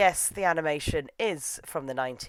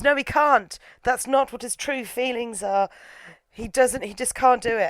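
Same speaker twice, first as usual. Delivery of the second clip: high, emphatic protest; mock dramatic refusal; 7 semitones higher than usual. Sakura backs out of the kiss, mock dramatic. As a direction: blurt it with hammed-up alarm.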